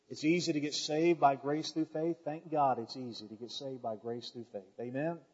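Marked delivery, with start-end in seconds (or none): none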